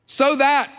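"So that" lifted and loud; stress on so that. Shown as a demonstration. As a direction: land hard on "So that". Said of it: "that's the phrase you use".

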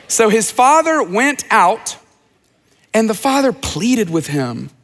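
normal talking voice

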